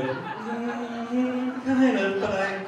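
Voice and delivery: high, drawn-out hedging hum; sheepish stalling. Retold or imitated. Stalling on a retold phone call.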